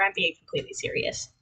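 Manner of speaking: quick whispered joke; fast whisper. Real, not acted. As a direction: murmur it fast and deadpan.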